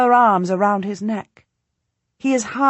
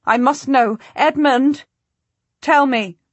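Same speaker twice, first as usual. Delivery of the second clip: higher, louder, pleading; desperate plea; 6 semitones higher, 6 dB louder than usual. Acted. Queen begging Edmund for news, high desperate cry. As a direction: demand the news in panic.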